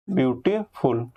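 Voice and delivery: slow "beautiful", paused between beauty and फुल; split into syllables. Shown as a demonstration. Teacher demonstrating syllables to students, "beautiful" slowly split in two. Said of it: "आप एक बार में बोल ही नहीं पाओगे".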